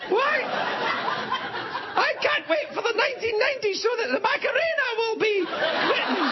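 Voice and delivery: very high, squeaky mock mum voice with laughter. Mum listening to the pop charts, very high laughing mock voice. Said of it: "my mum would even listen".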